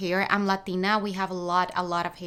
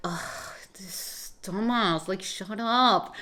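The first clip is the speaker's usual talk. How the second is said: annoyed Feyre; 3 semitones lower, 4 dB quieter, slower than usual. Retold or imitated. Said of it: "imagine Fairy being like".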